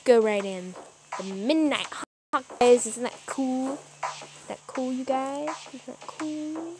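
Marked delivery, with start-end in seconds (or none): 3.7-5.5 hushed aside. Shown as a demonstration. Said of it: "repeated takes". 5.7-6.8 soft, slow, put-on "Isn't that cool?"; slow hushed aside; mock whisper. Shown as a demonstration. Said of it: "repeated takes".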